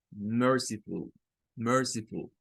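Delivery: «merciful» con schwa suave en «ful»; schwa final. Shown as a demonstration. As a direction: di «merciful» con la última vocal reducida, tono descendente. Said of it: "un sonido de un schwa". («merciful» con «ful» átono, casi sin U)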